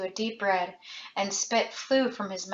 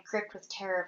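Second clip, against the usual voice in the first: plain reading voice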